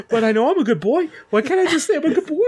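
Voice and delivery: high, rising whine of a dog wanting praise; pleading pug. Retold or imitated. Player imitating the pug begging to be the good boy, high and whiny. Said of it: "he's like".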